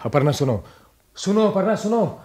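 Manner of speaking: calling after her. Acted. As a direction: call her back before she goes. Husband calls after leaving wife. (जाती पत्नी को ऊँची आवाज़ में पुकारना)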